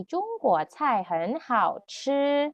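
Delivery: "中国菜很好吃" repeated slowly and clearly. Shown as a demonstration. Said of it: "Kita lihat sekali lagi".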